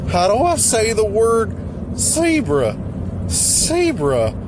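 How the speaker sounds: high put-on character voice; weird character voice. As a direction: puzzle over the word in character. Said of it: "sometimes you get to, like".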